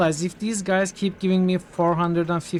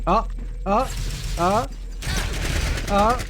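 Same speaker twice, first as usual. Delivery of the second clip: startled; 5 semitones higher, slower than usual. Real, not acted. Sudden ambush in the game.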